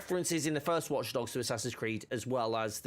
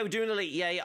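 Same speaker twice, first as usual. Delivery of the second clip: ordinary delivery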